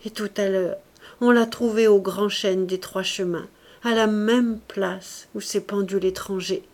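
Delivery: plain reading voice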